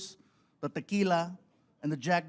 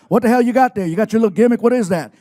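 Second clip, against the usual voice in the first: loud gruff questioning; Stone Cold voice; 4 semitones higher, 14 dB louder, faster than usual. Retold or imitated. Wrestler demanding what the gift is, loud gruff. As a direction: bark it like a suspicious tough guy.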